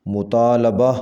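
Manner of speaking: مطالبہ with a short a after l, "mutaalaba"; correct pronunciation. Shown as a demonstration. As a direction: say مطالبہ as mu-taa-LA-ba. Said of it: "صحیح تلفظ ہے".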